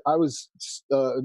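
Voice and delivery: usual speaking voice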